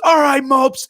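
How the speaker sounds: high, loud bellow; drill instructor shout. Retold or imitated. Once-sweet instructor ambushing recruits at orientation, shouting. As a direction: round on the new recruits.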